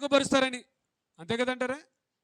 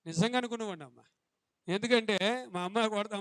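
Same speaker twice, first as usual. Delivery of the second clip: usual speaking voice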